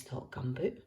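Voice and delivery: usual speaking voice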